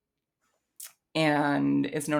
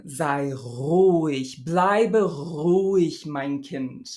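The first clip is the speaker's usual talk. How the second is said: slow theatrical German with trilled uvular r; dramatic poem recitation; 4 dB louder, much slower than usual. Shown as a demonstration. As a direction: declaim it like an old poem. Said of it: "felt kind of dramatic".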